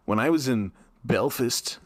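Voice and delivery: light imitation of a podcaster; slow, deliberate mock retelling; Joe Rogan. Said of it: "hear about Joe Rogan saying".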